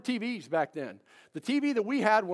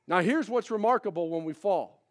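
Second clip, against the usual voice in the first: usual speaking voice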